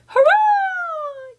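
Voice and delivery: high, loud cheering cry; crowd yell. A narrator's reading. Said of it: "yelled the crowd".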